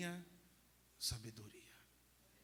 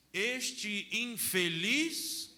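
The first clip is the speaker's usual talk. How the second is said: drawn-out, louder, higher quote of the psalm; slow intoned quote; 7 semitones higher, 11 dB louder, much slower than usual. Real, not acted. Priest quoting a psalm slowly and loudly. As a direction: stretch out Este infeliz. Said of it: "slow quoted psalm".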